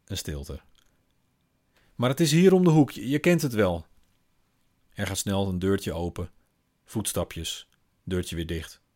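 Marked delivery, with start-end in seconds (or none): 1.9-3.9 high, louder insistent push; urging mother. Acted. Mother urges her daughter to go alone, high and loud. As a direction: send her off quickly.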